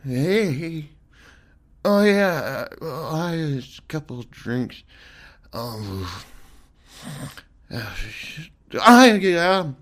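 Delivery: drowsy, mumbling tipsy voice; sleepy drunk. Acted. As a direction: mumble half asleep. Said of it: "Or are you a sleepy drunk?".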